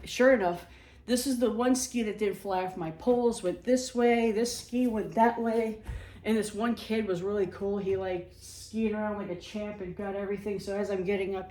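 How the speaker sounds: normal talking voice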